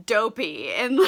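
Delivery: usual speaking voice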